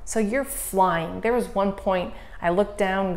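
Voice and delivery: usual speaking voice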